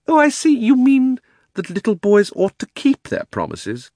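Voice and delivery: high, loud lofty lecture; pompous. Acted. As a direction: lecture grandly. Uncle pompous.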